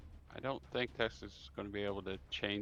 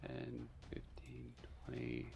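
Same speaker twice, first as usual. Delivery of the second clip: counting under breath; 12 dB quieter than usual. Real, not acted. Game master counting the second zombie's movement, under their breath. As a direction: count quietly to yourself, unhurried.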